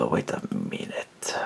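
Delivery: breathy whisper to himself; whispered aside. Real, not acted. Whispering to himself while looking something up. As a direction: drop to a whisper while searching.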